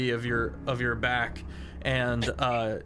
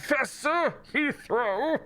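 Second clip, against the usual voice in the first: high-pitched haughty professor; professor orb; 11 semitones higher than usual. Acted. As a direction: correct them in a high, self-important professor voice. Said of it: "it is a professor orb".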